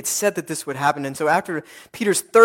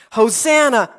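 high, raised cry of Hosanna; 6 semitones higher, 4 dB louder than usual. Acted. Preacher gathers the cries Jesus carries, high pleading cry. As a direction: call it out high, as a plea. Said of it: "those cries".

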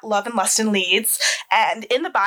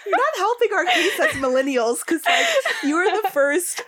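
laughing; 9 semitones higher than usual. Real, not acted. Host laughing while teasing.

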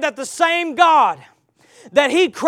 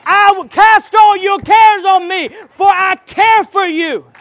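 loud, high, slow cry; shouted scripture, slowed down; preaching shout; 7 semitones higher, 10 dB louder, slower than usual. Acted. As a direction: lift it and cry out the promise. Quoting God, slow and shouted.

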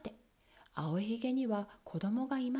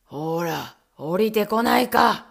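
Bluebeard bellowing; 2 semitones lower, 12 dB louder, slower than usual. Acted. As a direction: roar impatiently up the stairs. Bluebeard shouting up.

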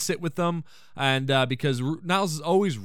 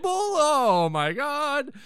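amazed; 9 semitones higher, much slower than usual. Real, not acted. Own reaction to the joke.

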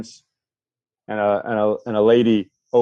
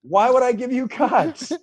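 laughing; 12 semitones higher than usual. Retold or imitated. Retold quote, high laughing.

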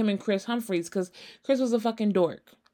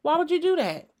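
incredulous; 6 semitones higher than usual. Retold or imitated. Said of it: "And I'm like".

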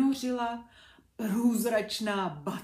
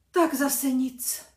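very slow, louder, sighing letdown; disappointed sigh; 5 semitones higher, 10 dB louder, much slower than usual. A narrator's reading. Ghost maiden sighs as the knight faints, disappointed. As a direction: sigh it out, let down again. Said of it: "zklamaně vzdychla Kristýna".

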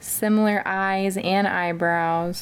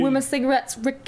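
mock prisoner calling out for Ricky; calling out to a buddy; 5 semitones higher, faster than usual. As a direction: call Ricky like an inmate greeting a friend.

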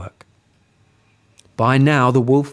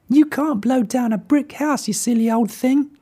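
high pig voice, mocking; teasing little pig; 12 semitones higher than usual. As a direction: scold him playfully.

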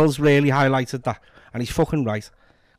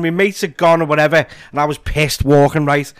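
ordinary talk

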